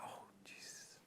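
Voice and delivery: stunned, barely voiced whisper; shocked whisper. He hears the doctor died, whispered shock. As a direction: let it fall out in a hush. His shocked reply, retold.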